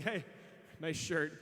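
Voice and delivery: low-pitched mocking remark; same praise turned into a jab; snide compliment. Same words meant as an insult.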